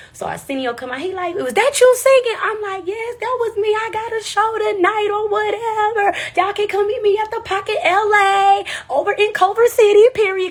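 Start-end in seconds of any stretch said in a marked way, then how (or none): 1.3-10.5 hyped plugging. Retold or imitated. Hyped exchange with Arsenio.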